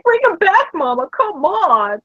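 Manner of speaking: high, drawn-out mock plea; sad pleading dog. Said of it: "she just was so sad".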